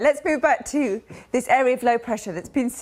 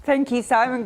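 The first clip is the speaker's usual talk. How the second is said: ordinary talk, nothing marked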